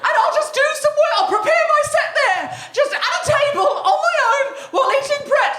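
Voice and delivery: high, squeaky, petulant huffing; mock-sulky high voice; whiny high huff. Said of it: "No, everything I was saying was logical".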